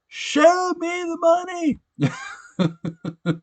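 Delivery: high, slow, stretched-out movie line; Jerry Maguire. Retold or imitated. Fan quoting Jerry Maguire to viewers, slow high cry. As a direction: drag it out like the famous movie cry. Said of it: "have you seen Jerry Maguire?".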